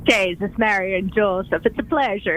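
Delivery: playful Irish lilt on the greeting; mock Irish blessing, hammed up a touch; light stage Irish brogue. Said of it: "she's got a bit of the brogue".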